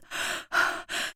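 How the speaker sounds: gasping for air after running; ragged gasps from a man out of breath; panting gasps; breathless gasps. A narrator's reading. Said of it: "he could only speak in gasps".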